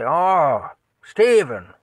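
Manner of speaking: loud and high, relieved call; calling back. Retold or imitated. Uncle calling from the dark house to his nephew, loud relieved shout. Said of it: "Like that".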